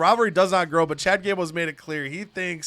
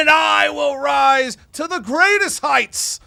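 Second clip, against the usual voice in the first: high, slow, booming stage oratory; dramatic proclamation; 8 semitones higher, 6 dB louder, slower than usual. Acted. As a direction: proclaim it like a hammy actor. Dramatic speech with fed lines.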